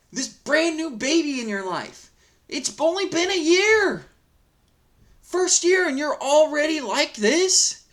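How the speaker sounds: can't believe it, voice climbing high; exasperated. Real, not acted. Vlogger scolding the father in the post, high and exasperated.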